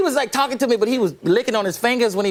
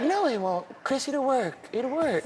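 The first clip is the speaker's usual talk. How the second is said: soft, higher, gentle voice; 5 semitones higher, 7 dB quieter, slower than usual. Retold or imitated. Star reassuring his friend about the mask, soft coaxing. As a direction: coax softly in a light voice. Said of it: "He was like".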